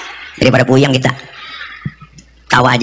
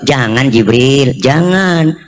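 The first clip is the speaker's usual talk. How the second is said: slow, low-pitched pleading restraint; unhurried, calming "don't"; gentle refusal; 6 semitones lower, much slower than usual. Acted. Prophet gently stops the angel.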